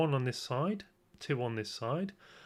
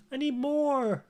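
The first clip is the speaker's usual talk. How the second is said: high, loud hammed-up American cry for more; mock American accent; 11 semitones higher, 6 dB louder, slower than usual. Vlogger hamming up an Oliver Twist plea, American accent. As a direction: belt it out in a put-on American accent. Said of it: "doing an American accent".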